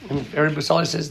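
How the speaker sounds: normal talking voice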